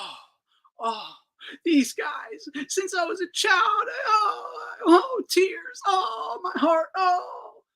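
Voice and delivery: high, whiny fake crying; mocking sob with a rising wail; mock sobbing. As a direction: sob it out like a crocodile-tears pundit. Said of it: "You're lying".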